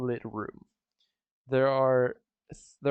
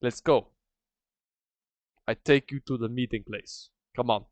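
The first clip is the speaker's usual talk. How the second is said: put-on Luke's brisk commands in a Russian accent; lightly played Russian-accented guide; 2 semitones higher, 8 dB louder than usual. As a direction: order the party along in a mock Russian accent. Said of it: "she talked like Russian".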